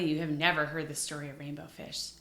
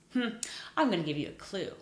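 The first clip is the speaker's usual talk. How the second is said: ordinary delivery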